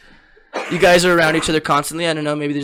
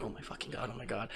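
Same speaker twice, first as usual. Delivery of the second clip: panicked mutter; 5 semitones lower, 21 dB quieter than usual. Retold or imitated. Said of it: "I'm like".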